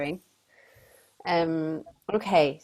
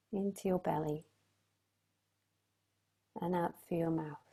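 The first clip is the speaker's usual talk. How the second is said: soft spa whisper; 3 semitones higher, 6 dB quieter than usual. Acted. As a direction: soothe them softly into calm. Leads a sound healing meditation.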